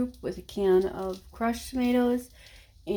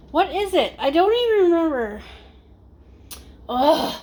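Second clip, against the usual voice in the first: frustrated; 7 semitones higher, 5 dB louder than usual. Real, not acted. Frustrated forgetting the word.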